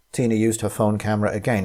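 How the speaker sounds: ordinary delivery, nothing marked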